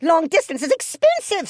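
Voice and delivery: anxious objection; cried. A narrator's reading. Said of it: "Sister cried".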